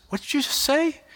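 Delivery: as if you misheard something alarming; stunned disbelief. Retold or imitated. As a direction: pitch shoots up in a shocked double-take. Dad shocked by son's news.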